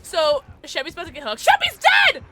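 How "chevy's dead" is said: high, loud shriek of dismay; laughing disbelieving shriek; loud high wail. Real, not acted. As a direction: cry out as he dies. Teammate dies.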